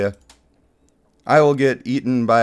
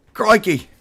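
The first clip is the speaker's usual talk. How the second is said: higher, hammed-up Aussie exclamation; a Brit's playful Australian accent; a quick jokey Australian accent; mock Australian accent; 7 semitones higher than usual. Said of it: "I sound like a Brit trying to be Aussie".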